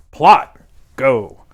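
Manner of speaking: short loud brush-off; curt dismissive shout. Retold or imitated. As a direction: snap out both words loud and high. Character curtly dismisses the question.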